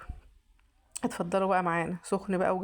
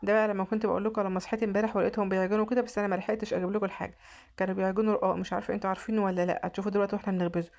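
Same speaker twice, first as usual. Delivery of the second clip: ordinary talk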